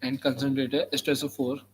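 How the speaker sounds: slow, measured dictation; dictating. Shown as a demonstration. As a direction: read it out for students to copy. Dictating notes to students.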